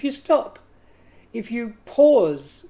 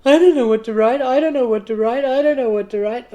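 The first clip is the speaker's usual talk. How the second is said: loud, higher, stuck-record chant; frantic repetition; 4 semitones higher, 9 dB louder than usual. Retold or imitated. Writer stuck at the timer, chanting loud and higher. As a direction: rattle it off like a panicked loop. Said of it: "even if it's".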